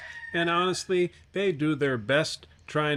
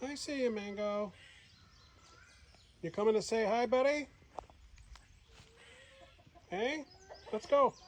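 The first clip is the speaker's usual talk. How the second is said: pet talk voice; 9 semitones higher, 8 dB quieter than usual. Real, not acted. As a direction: coax the cat to come. Calling the cat Mango.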